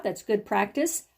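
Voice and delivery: ordinary talk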